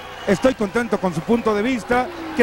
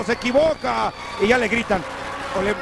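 usual speaking voice

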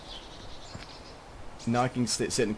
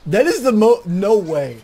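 disbelief; 9 semitones higher, 12 dB louder, slower than usual. Real, not acted. Reacting to a chicken target.

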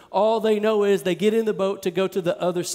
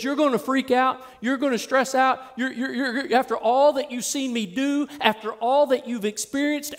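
higher-pitched, driving sermon delivery; impassioned preaching; 5 semitones higher than usual. Acted. Preacher voicing Jesus's rebuke to doubting disciples, impassioned preaching. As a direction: build repeated phrases like a fired-up preacher.

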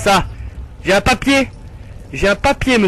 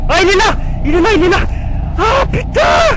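high, loud panicked shouting; real panic, voice shooting up; freaking out; 12 semitones higher, 5 dB louder than usual. Real, not acted. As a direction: scream in alarm, stretching the last cry. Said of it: "Ah, je flippe!".

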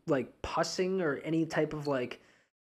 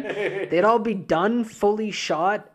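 slow and exaggerated; 7 semitones higher, 6 dB louder, much slower than usual. Mocking the idea, laughing.

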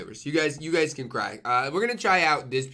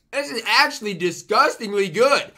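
excited; 6 semitones higher, 6 dB louder than usual. Real, not acted. Delighted by the food.

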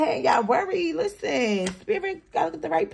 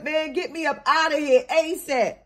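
ordinary talk, nothing marked

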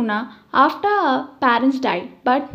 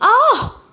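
short, high-pitched exasperated "Ah!"; acted outburst of anger, pitch leaping up; angry cry; 11 semitones higher, 4 dB louder than usual. A narrator's reading. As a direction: let out a sharp, high cry as you strike. Boss slaps underling in anger.

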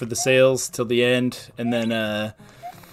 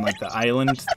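normal talking voice

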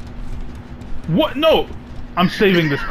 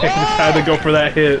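laughing shout. Real, not acted. Laughing at a failed play.

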